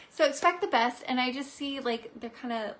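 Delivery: normal talking voice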